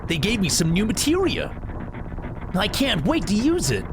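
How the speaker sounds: put-on high, eager kid voice; bright and eager like a kid; young voice. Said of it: "Just like a kid".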